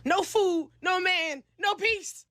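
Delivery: high, punched three-beat complaint; exasperated listing. Retold or imitated. Lists grievances in exasperation.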